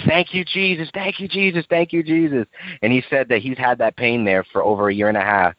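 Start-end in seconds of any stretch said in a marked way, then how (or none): 0.1-2.5 high-pitched joyful cry, partly imitated; retold screaming praise. Healed boy shouting thanks while running around classroom, high excited cry. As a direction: squeal the thanks like a healed kid. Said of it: "start screaming".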